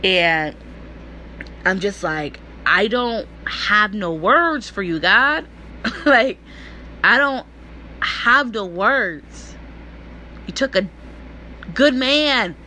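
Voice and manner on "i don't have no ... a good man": slow, halting, close to crying; holding back tears. Real, not acted. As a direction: fight tears while speaking to God. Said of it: "I'm trying not to cry".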